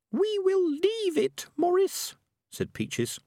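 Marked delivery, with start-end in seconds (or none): 0.1-2.2 very high, slow squeaky rat voice; prim little rat. A narrator's reading. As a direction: scold him gently from way up high. Peaches voiced.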